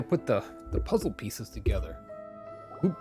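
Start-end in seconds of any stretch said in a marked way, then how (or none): none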